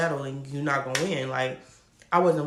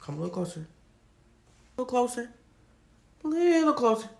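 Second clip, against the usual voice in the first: building suspense; 7 semitones higher, much faster than usual. Real, not acted.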